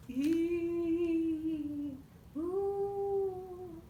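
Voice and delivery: high eerie hoot; ghost hoot. Acted. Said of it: "an eerie ghost sound".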